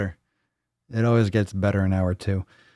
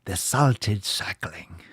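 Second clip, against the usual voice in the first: slow hushed whisper of "the Salted Sackling"; much slower than usual. Shown as a demonstration. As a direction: whisper it like a legend. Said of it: "repeats title".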